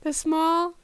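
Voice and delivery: usual speaking voice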